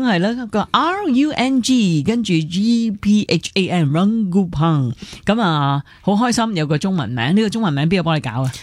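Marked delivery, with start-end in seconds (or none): 0.0-4.9 slow letter-by-letter R U N G, G P H A N; spelling out the name. Shown as a demonstration. Host spells the guest's name for radio listeners, slow and clear. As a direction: spell Rungupang out slowly, letter by letter. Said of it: "Tatema Rungupang。".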